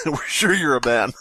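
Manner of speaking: usual speaking voice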